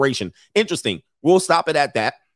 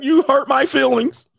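high, slow, whiny mock voice; whiny millennial; 13 semitones higher, 5 dB louder, slower than usual. Millennial complaining to parent, whiny high voice. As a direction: whine like a hurt kid. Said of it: "Millennials like".

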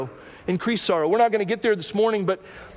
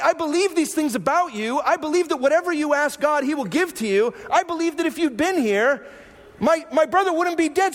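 frustrated Martha; 8 semitones higher than usual. Retold or imitated. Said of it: "she goes".

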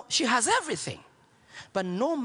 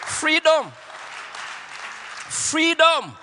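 much louder, higher triumphant shout; 8 semitones higher, 6 dB louder, much slower than usual. Acted. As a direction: proclaim it to the whole church. Climactic preached shout.

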